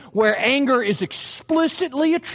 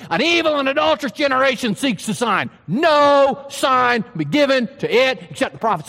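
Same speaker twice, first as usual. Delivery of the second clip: angry rebuke. Acted. Jesus rebuking the Pharisees who demand a sign, shouting. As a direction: hurl it back at the sign-seekers.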